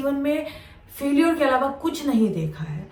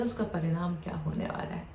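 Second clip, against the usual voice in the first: ordinary delivery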